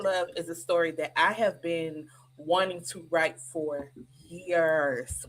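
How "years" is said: "years" stretched out long and slow; "years" dragged for emphasis; emphatic YEARS. Real, not acted. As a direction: draw out "years" to show how long it was. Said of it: "When I say years, I mean years".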